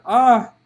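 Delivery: loud high labour scream; frantic loud yell. Acted. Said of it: "Farah screamed frantically".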